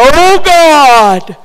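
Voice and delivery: loud, high, drawn-out mock prayer cry; overdone prayer. Mocking people who pray too dramatically, loud hammed-up wail. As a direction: ham it up like a showy pray-er. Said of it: "you know people that do that".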